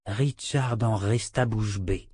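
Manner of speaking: plain reading voice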